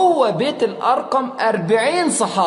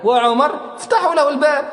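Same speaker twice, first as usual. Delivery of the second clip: وعمر افتحوا له الباب said high and commanding; firm defiant challenge, unafraid; 8 semitones higher, 3 dB louder than usual. Retold or imitated. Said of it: "قال حمزة في صلابة". (حزم وتحدٍ)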